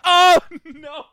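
very high, loud yelp of dread; mock horror. Girlfriend tells everyone about the podcast, mock horror. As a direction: replay a horrified cry, half laughing.

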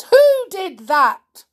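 loud, high, drawn-out demand; outraged elephant, just bitten; indignant shout. Acted. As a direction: bellow it slowly in shock. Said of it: "deep voice".

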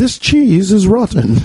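high, hammed-up British accent. Host demonstrating on request, high mock British accent. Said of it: "do a British accent".

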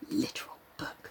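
breathy whisper of the words; soft whispered aside. Real, not acted. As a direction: whisper it to yourself.

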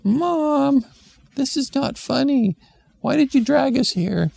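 put-on high, indignant kid; child voice. Kid asking mom why they came, high indignant voice. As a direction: complain like an annoyed child.

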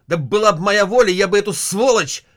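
loud, high furious outburst; husband rages to wife about the army, loud anger; angry outburst. Acted. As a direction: explode at the useless troops. Husband rages at red regiments.

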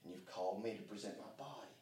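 soft, low intimate prayer, almost breathed; hushed prayer. Shown as a demonstration. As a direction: pray it quietly, near a whisper. Quiet closing prayer.

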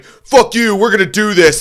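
loud, high-pitched defiance; retold angry acceptance. Singer taking up the fight from the stage, loud angry defiance. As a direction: shout it as a furious dare.

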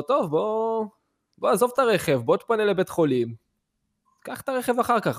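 lightly acted concern, pushing him along; urging.

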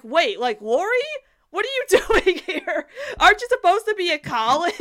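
high, loud, laughing disbelief; laughing surprise. Retold or imitated. Fan spotting Laurie in the crowd, laughing surprise. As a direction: burst out laughing in surprise at seeing her. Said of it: "and I'm like".

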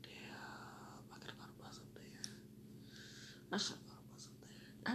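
whispered to oneself; whispered regret. Retold or imitated. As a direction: say it in a barely voiced whisper. Retelling own thought under breath.

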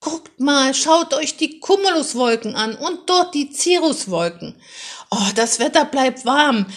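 put-on a kid loudly showing off his cloud knowledge; excited bellow. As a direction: shout it out, bouncing in the back seat. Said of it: "und brüllte".

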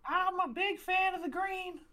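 very high cartoon mouse voice; Mickey Mouse. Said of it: "Mickey, how you doing?".

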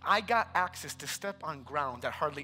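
low, soft storytelling; hushed testimony. Real, not acted. Preacher recalling Palm Sunday in Israel, hushed. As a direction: drop right down to share an awed memory.

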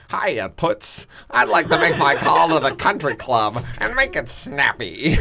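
hint of a snappy old-timey caller; breezy 1920s slang delivery, lightly done; 1920s fast talker. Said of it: "why are you talking like a nineteen twenties".

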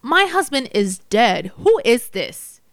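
slow, upset demand; indignant and hurt; snapped. A narrator's reading. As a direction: confront the caller, drawing out the question. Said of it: "she snapped".

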